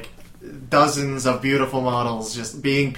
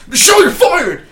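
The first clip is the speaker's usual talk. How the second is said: much higher, loud yelled dismissal; hammed-up boss yell; mock firing shout; 11 dB louder than usual. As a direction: scream the firing like a clip. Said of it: "the voice line, being like".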